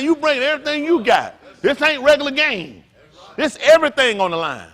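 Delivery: high, loud preacher's build; a revival preacher hammering every line; full-force preaching. Acted. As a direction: drive it home to the congregation.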